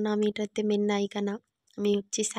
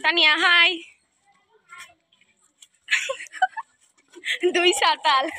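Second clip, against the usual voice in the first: excited; 8 semitones higher, 6 dB louder than usual. Real, not acted. Excited greeting to friend.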